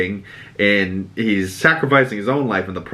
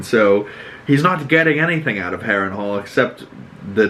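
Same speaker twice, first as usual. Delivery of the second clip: ordinary delivery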